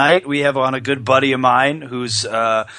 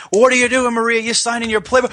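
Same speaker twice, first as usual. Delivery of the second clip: loud, high mock wrestler holler; Ric Flair impression; 11 semitones higher, 3 dB louder, faster than usual. Host imitating wrestler about to be shocked, high raised voice. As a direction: call it out high, cut off mid-thought. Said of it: "So he goes".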